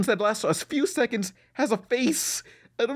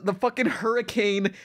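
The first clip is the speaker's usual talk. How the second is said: normal talking voice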